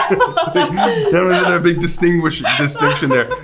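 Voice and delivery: laughing through the words. Real, not acted. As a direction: speak while laughing hard. Laughing while telling story.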